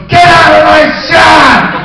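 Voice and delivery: loud, high, slow furious yell. Retold or imitated. Director yelling at hair people mid-take. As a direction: chase them out of frame. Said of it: "He yells it during the shot".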